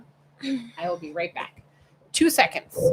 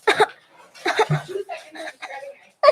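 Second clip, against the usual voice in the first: laughing; 12 semitones higher, 6 dB louder, much slower than usual. Real, not acted. Laughs while talking.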